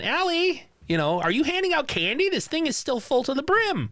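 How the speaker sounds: high, calling mom voice; high-pitched mom calling for her daughter. Retold or imitated. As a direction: call up the stairs like a worried mom. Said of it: "Her mom comes home and is yelling".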